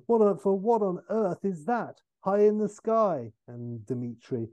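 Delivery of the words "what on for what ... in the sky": raised, mock-exasperated noblewoman; a hint of haughty impatience, pitch climbing; bossy countess. Countess cutting off her servant, mock exasperated. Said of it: "The countess".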